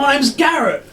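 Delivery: Garrett the captain. Acted. Charmed captain introducing himself to the pirates. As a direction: announce your name cheerfully.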